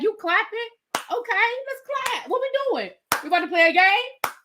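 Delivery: high, bouncy game-on voice; playful eager joining in. Pretend. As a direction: act keen to join the party. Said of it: "I probably would have done the same thing".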